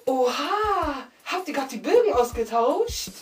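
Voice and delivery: high, drawn-out scandalised teacher voice; mock shocked teacher. Teacher catching pupils swapping exam sheets, mock shocked. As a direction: stretch it out in exaggerated outrage.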